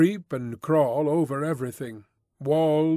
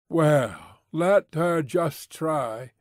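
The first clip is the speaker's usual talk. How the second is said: usual speaking voice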